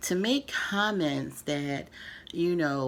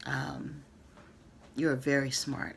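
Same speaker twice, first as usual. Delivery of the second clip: ordinary delivery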